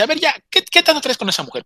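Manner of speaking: high-pitched impatient outburst; exasperated demand. Retold or imitated. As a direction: demand an explanation, pitch jumping up. Friend loses patience, demands story.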